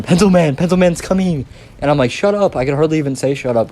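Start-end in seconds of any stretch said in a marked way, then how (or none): 0.0-1.5 high, loud mock announcement; imitated friend's warning. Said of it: "I think he was going". 2.1-2.6 high-pitched retold plea, mock cracking; cracky laughing voice. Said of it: "My voice is all cracky".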